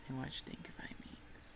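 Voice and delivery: whispered aside. Real, not acted. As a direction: drop to a breathy whisper. Catchphrase repeated as aside.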